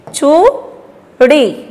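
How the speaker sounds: "चूड़ी" very slow, long rising "चू", pause, "ड़ी"; sounding out. Shown as a demonstration. Teacher showing children the long vowel, sounded out. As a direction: stretch "चूड़ी" in two pieces. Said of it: "जोर दे के बोलना".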